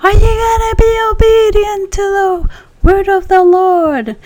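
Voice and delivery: high, loud preacher call, voice lifted well up; booming preacher. Retold or imitated. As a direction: call it out like an evangelist from the pulpit. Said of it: "And he goes".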